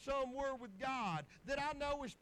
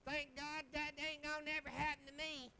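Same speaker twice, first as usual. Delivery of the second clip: higher, hammed-up wife impression; mock wife voice; 4 semitones higher than usual. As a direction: exaggerate her way of talking, pitched up. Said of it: "I'm gonna talk like that".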